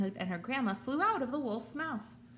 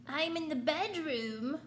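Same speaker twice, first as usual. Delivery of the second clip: higher, slow fake granny voice; wolf pretending to be grandma; strange wobbly voice; 7 semitones higher, slower than usual. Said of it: "in a strange, wobbly voice".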